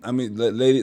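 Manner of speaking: ordinary talk, nothing marked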